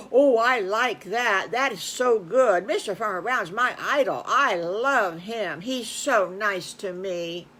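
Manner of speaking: slow, loud, drawn-out adoration; lovestruck, lingering fan gushing; gushing admiration. Acted. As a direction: gush about your idol, stretching the words. Wolf gushing over Farmer Brown.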